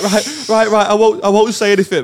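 mock-flustered reassurance; agitated promise. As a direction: act out a rattled kid agreeing. Child agreeing while upset, retold.

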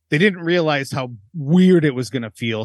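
ordinary talk

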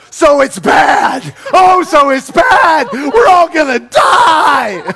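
slow, loud, high wailing despair; hammed-up doom, dragging each word; mock panic. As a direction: melodramatic fake sobbing shout. Panicking over the appraisal email.

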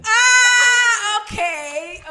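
high, loud squeal of protest; excited squeal. Real, not acted. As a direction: yelp in embarrassed protest. Reacts to being exposed.

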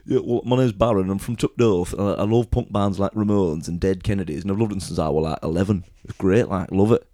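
loud, thick Yorkshire punk lad; imitating a Yorkshire lad's accent. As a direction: put on a big, hearty Yorkshire accent. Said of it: "he just had the most incredible accent".

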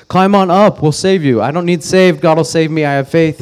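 the helicopter crew and the drowning man, shouted joke voices; mock insistent shout. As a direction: play the stubborn man yelling his faith. Retold flood joke, rising.

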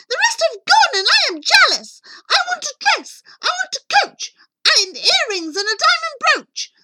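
high, screeching tantrum; shouted demand. Acted. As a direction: shriek a fast, greedy list of demands. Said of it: "and shouted".

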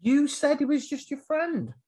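squeaky high retold line; a high, pointed mock accusation. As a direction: pitch it way up like a shocked friend. Voicing other character, high.

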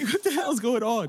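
high-pitched incredulous outburst; retold disbelief. Store clerk reacting to another sandy console, incredulous. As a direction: exclaim it high in mock disbelief.

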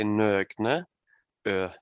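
"Nøgne Ø" said the Norwegian way; "Nøgne Ø" as a Norwegian says it, ending on rounded Ø; Norwegian pronunciation. Shown as a demonstration. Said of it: "in Norwegian we say".

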